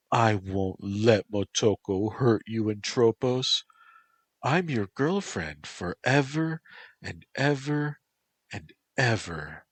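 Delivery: high, sing-song reassurance. Acted. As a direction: soothe him like a little child.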